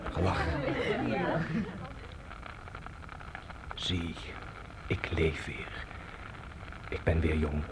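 soft, drifting half-whisper; lost in memory, fading away to nothing; dreamy murmur. Acted. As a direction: sink into a dream, barely voicing it. Old man drifting into dream memory.

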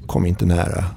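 usual speaking voice

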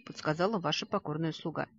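ordinary delivery

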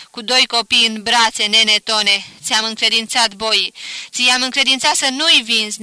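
loud wailing complaint to the dead; crying out. A narrator's reading. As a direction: lament loudly, half grief half grievance. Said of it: "strigă".

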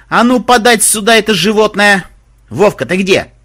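high, loud demand; imperious shout. Acted. Mahmud demanding the animal back, imperious high shout. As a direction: holler for it to be brought at once.

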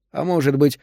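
ordinary delivery, nothing marked

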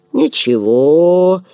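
slow, savoured drawl; relishing drawl. Acted. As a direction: stretch it out with relish. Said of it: "смачно протянул он". (смачно, протяжно)

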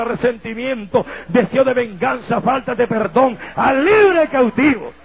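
impassioned preaching prayer, rising to a cry; preacher pleading with God, building to a cry; fervent prayer. Acted. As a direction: pray aloud over the church with growing fire. Opens a prayer over the congregation.

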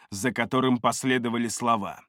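ordinary delivery, nothing marked

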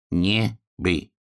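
halting, syllable-by-syllable reading. A narrator's reading. Hedgehog reading crooked letters on a shed, halting syllables. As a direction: sound it out, one syllable at a time. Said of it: "по складам прочитал ежик".

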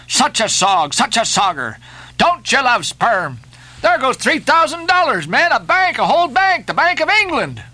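high, pleading excitement in bursts; hopping-up-and-down eagerness, pitch leaping; excited cry. A narrator's reading. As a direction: beg your lads frantically to pull. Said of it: "cried Flash, dancing up and down".

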